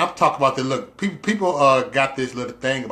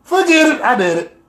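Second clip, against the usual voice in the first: excited outburst; 11 semitones higher, 7 dB louder than usual. Real, not acted. Deciding to make the call.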